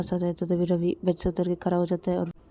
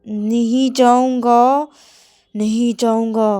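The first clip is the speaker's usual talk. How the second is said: loud, very slow drawn-out refusal; slow loud defiance; stubborn refusal; 5 semitones higher, 11 dB louder, much slower than usual. Acted. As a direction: drag out the no. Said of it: "तो मेरा बोलते".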